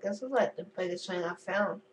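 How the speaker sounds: ordinary talk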